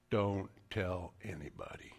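voiceless whisper; hushed warning to Peter; whispered command. Retold or imitated. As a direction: breathe it as a secret.

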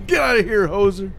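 put-on loud, high Canadian accent. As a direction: shout it like a hoser Canadian. Canadian impression.

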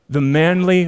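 ordinary talk, nothing marked

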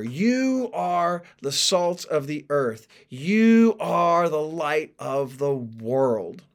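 YOU drawn out and leaned on; slow, weighty verse with a long YOU; emphatic quote. Shown as a demonstration. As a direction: quote it slowly, stretching each "You". Quotes verse, leaning on each you.

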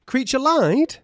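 high, loud disbelieving question; shocked Harry. Retold or imitated. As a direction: gasp it out in mock disbelief. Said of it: "Harry's like".

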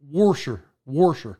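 "Warsher" with an added R in washer; mother-in-law's pronunciation. Retold or imitated. Host quoting his wife's mom, washer with intrusive R. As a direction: put an R into "Warsher". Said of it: "my wife's mom says".

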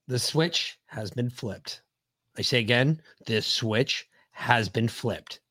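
very low, slow mock announcer; deep announcer voice. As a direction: intone it like a grave official broadcast. Mock announcement of flipping switch.

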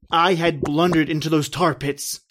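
normal talking voice

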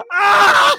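high loud shriek; startled yelp. Retold or imitated. Host reenacting his own reaction, a high shriek. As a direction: shriek like you got splashed. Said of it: "I was like".

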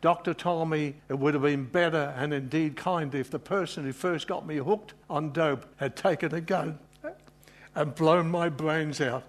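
normal talking voice

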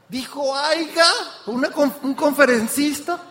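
high-pitched, scandalized disbelief; mock incredulous. Speaker retelling shock at a lecturer's grammar, high and incredulous. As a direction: question it as if you cannot believe it.

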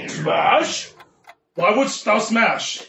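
slow, archaic godly speech; solemn Asgardian asking why; Thor voice. Acted. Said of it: "I'm gonna be Thor".

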